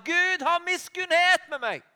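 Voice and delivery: high, loud begging cry. Retold or imitated. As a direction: throw the plea out even harder. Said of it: "bare ropte enda mer".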